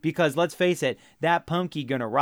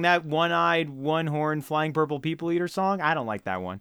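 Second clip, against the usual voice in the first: usual speaking voice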